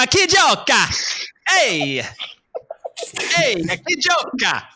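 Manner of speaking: high, drawn-out shout in hammed-up Italian; imitated screaming. Imitating B calling to the waiter across the restaurant, mock screaming. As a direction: holler it slowly across the room, mock Italian. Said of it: "He was screaming it across".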